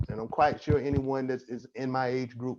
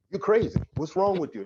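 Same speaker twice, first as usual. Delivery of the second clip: higher, louder mock of a scoffing friend; 6 semitones higher, 4 dB louder, faster than usual. Friend scoffs at a man seeking therapy, mocking and louder.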